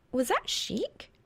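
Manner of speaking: lightly voiced wondering girl; Reaper's voice. A narrator's reading.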